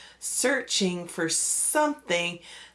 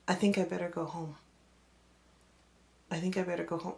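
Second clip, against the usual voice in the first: soft, frightened murmur; softer, worried murmur of a scared girl; 8 dB quieter, much faster than usual. Retold or imitated. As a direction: quietly insist on going home. Scared friend wanting to leave.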